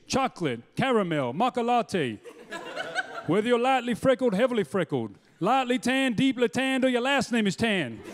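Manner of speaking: civil rights preacher's ringing oratory; measured, rising sermon cadence with pauses between phrases; Martin Luther King. Acted. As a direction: deliver a parody speech like a rousing preacher. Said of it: "a guy by the name of Martin Luther King".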